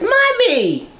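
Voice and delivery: put-on high, thin little child calling out; small child's voice. Unborn baby calling to his mother in a high child's voice. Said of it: "The voice says".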